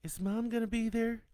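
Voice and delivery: put-on high, loud little-girl question; child's voice. A narrator's reading. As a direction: ask as a small daughter, pitched well up. Child asks father anxiously.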